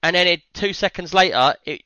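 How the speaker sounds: normal talking voice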